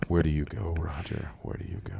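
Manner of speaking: soft whispered aside. Real, not acted. Trailing off to himself after a laugh, whispered. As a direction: breathe it out as a whisper.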